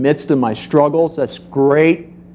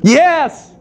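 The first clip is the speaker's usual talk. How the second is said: high, loud yes; excited cheer; 13 semitones higher, 4 dB louder than usual. Retold or imitated. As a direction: burst out with joy. Dad cheering daughter's success.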